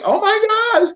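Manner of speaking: very high mock-shocked squeal; shocked exclamation. Santa reacting to the door slammed in his face, high retold shock.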